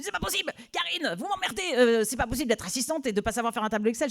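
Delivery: high, fast exasperated rant; exasperated boss. Retold or imitated. Boss exasperated that the temp can't do Excel. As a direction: fume in disbelief.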